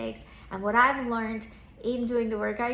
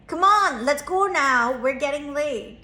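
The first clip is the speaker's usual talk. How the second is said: louder, higher urgent hurry-up; impatient call; 4 semitones higher, 7 dB louder than usual. Retold or imitated. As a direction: push him out the door, impatient. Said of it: "And I'm like".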